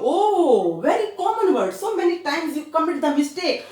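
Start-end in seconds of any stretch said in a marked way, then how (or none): none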